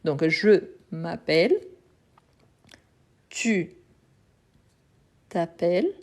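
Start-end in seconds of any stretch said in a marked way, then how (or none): 3.3-5.9 t'appelles with an open è, the final L held long; pronunciation demo. Shown as a demonstration. Teacher modelling t'appelles for the class. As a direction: model t'appelles slowly, one word at a time. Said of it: "La marque du tu".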